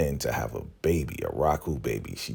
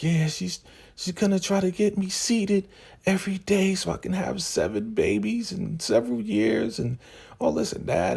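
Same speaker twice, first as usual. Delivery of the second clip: much higher, squeaky put-on girl voice; imitating Lacey. Recapper voicing Lacey explaining the breeding plan, high put-on girl voice. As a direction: pitch way up into a mock young woman's voice.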